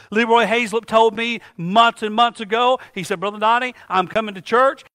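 ordinary delivery

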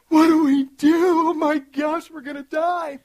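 high, loud, slow panicked wail; hammed-up terror, as if about to die; mock panic. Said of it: "I'm like".

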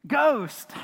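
high, loud mock cry of fright; terrified cry. Preacher voicing disciples who think they see a ghost, terrified cry. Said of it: "they're just terrified".

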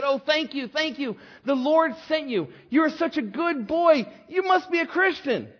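high, excited outpouring of thanks; gushing gratitude. Acted. Relieved woman hugging her rescuer, gushing high.

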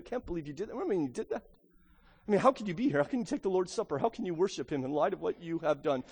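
soft, sly accusing voice; accusing whisper. Pretend. Preacher voicing sin accusing the believer, softly whispering. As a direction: whisper it like sin in someone's ear. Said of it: "whispers in your ear".

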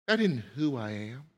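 ordinary delivery

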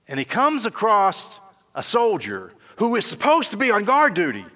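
fervent sermon delivery; preaching at full force. Acted. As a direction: drive the story home from the pulpit. Preacher heightening story.

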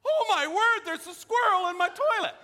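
high, loud, stunned exclamation; shocked disbelief. Retold or imitated. Friend seeing the squirrel, a high loud shocked cry. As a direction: imitate a friend gasping at the sight. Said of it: "and he's like".

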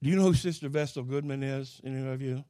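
ordinary delivery

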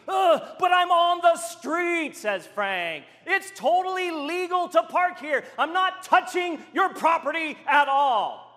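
loud, high, exasperated shout; heated protest. Retold or imitated. Frank shouting back at the homeowner that parking is legal. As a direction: push back hard, insisting you're right.

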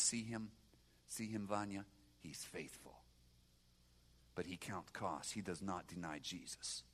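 a mother quietly telling her son to look; mama's hushed words. Retold or imitated. Mother pointing out the deacon.

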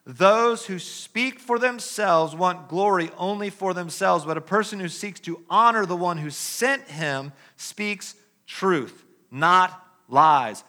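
loud, declaiming preacher's read; forceful scripture reading. Acted. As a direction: proclaim it to the congregation. Opening scripture read at volume.

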